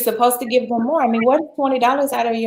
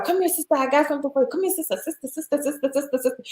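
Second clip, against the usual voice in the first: high, softer overlapping calls of sister; chorus of vendors; 6 semitones higher, 6 dB quieter, faster than usual. Retold or imitated. Whole market calling out to the American shopper, high and overlapping. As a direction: mimic a crowd of sellers calling from all sides. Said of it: "went up in a roar".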